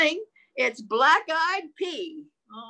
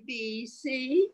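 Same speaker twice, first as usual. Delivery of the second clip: ordinary delivery, nothing marked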